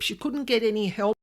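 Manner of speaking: ordinary talk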